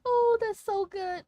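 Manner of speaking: high, slow, cutesy coo; cute anime streamer. Pretend. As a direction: swoon over it in a cute voice. Delighting in avatar expression.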